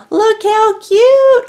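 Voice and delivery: squealing high and loud, drawn out slowly; excited. Real, not acted. Crafter delighted by her tiny clay fish, squealing. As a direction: burst out in delight. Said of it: "It makes me excited".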